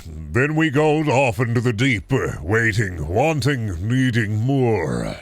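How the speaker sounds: slow dramatic voiceover, drawn out; deep performance voice. Shown as a demonstration. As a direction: pull it from the pit of the diaphragm. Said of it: "when I do, like, narration or V O work".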